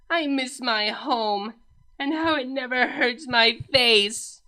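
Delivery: slow, strained voice of a princess in pain; pained Cadance. A narrator's reading. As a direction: drag each word out through the hurt. Cadance's suffering voice.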